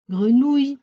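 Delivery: "Grenouille" ending in a plain "oui" sound; mispronounced. Shown as a demonstration. Teacher reading a word to pupils, mispronounced "Grenouille" as gre-nou-i. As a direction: say "Grenouille" as gre-nou-i, without the final y glide. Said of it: "C'est pas grenouille".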